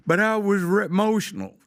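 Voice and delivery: mock the defendant's whine, pitch up; imitating a defendant who insists again; whiny mock defendant. Judge mocks defendant repeating excuse.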